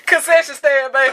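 loud, high hype call; playful call. Pretend. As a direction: call it out like a vendor. Joking call.

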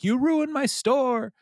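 very high, drawn-out mock wail; mock distraught wail. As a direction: stretch out the complaint, way up high. Ted's wrecked store, voiced high.

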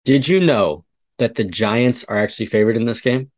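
ordinary talk, nothing marked

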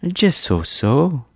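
high, slowly drawn-out modest reply. A narrator's reading. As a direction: stretch it out, playing down the praise. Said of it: "replied Tip modestly".

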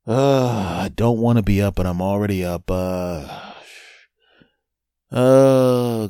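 drawn-out groan at being up early; reluctant groan. Acted. Already awake and not wanting to be, reluctant groan.

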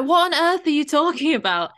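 imitating an exasperated podcast host, high and loud; mock-incredulous rant at a clueless guest; angry outburst. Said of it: "he gets angry".

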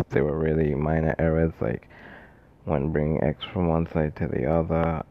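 talking after wisdom teeth removal; sore mouth. Real, not acted. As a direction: sore mouth, careful swallowing. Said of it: "Sorry if I sound bad. I got my wisdom teeth out and it hurts to swallow".

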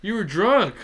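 loud, high indignant outburst. Real, not acted. Indignant at drunk driver.

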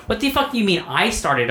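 high-pitched, incredulous comeback; indignant retort. Retold or imitated. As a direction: throw the blame back, replaying the row. Retold quarrel over who started.

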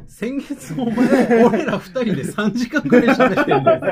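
laughing while talking, loud and high; words bursting through laughter. Real, not acted. Laughing at their long episode.

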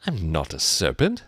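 ordinary delivery

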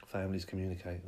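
ordinary delivery

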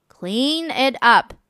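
slow, firm mother spelling it out; firm slow order. Acted. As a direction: mom putting her foot down, slowly. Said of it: "said the mother".

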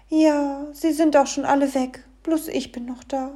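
high-pitched fairy-tale figure; little fairy tale. A narrator's reading. Fairy tale explains itself to the dwarf, high voice.

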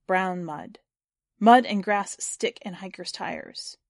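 ordinary delivery, nothing marked

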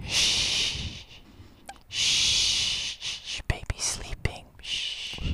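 put-on hushed baby-sleeping whisper. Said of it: "so we're just gonna whisper".

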